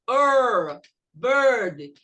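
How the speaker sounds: "Er, bird" loud with a held r; loud r-colored "bird"; ER sound. Shown as a demonstration. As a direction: make "bird" rhyme with a long "er". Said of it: "se a palavra for com I R".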